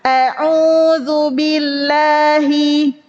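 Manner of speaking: A'udzu billahi, slow, read together by the class; slow group reading of A'udzu billahi; class recitation. Shown as a demonstration. Class recites A'udzu billahi together on cue.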